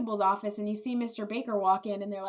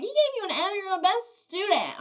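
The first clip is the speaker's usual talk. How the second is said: very high, hammed-up disbelief; mock outraged principal; 9 semitones higher, 3 dB louder than usual. Principal shocked at her best student's F, mock indignant. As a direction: gush in indignation for a favourite pupil.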